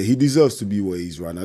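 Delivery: ordinary talk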